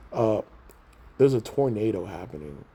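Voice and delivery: normal talking voice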